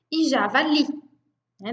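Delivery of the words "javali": "javali" with the final "li" strongest; stress on last syllable. Shown as a demonstration. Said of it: "a última sílaba é a mais forte". ("javali" com a última sílaba, "li", mais forte)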